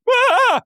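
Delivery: very high squealed singing; imitated crowd wail. Party guests failing to reach the pitch, mock high wail. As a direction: squeal as if straining for the note. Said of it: "and everyone goes".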